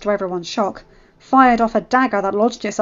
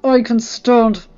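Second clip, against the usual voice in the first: halting, slow, strained and loud; pained, jaw clenched; 3 semitones higher, 8 dB louder than usual. Acted. Henry hurt but standing, speaking through clenched jaw. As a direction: push the words out through pain. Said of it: "his jaw clenched in pain".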